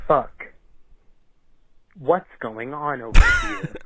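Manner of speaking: loud surprised shout; loud startled cop. Acted. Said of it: "Cop one".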